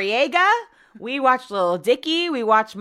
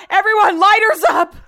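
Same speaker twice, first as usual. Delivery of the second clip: loud, high call to the audience; rally a festival crowd mid-song; mock rock-singer shout; 9 semitones higher, 8 dB louder than usual. Acting the singer addressing festival crowd.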